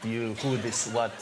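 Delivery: put-on low, slow generic European accent; fake European accent. Student fobbing off fundraisers, fake European accent. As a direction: play the baffled foreigner in a vague French-ish accent. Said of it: "generic European accent".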